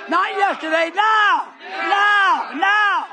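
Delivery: shouted, high pulpit climax; full-force preaching. Real, not acted. Preacher rouses congregation to present faith, full-force shouting. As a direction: build to pounding shouts of "now".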